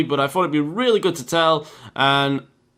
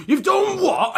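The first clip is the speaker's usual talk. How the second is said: much higher, squealing "You've done what?"; a brother who can't believe what he just heard; shocked outrage; slower than usual. Retold or imitated. Brother learns what sister did.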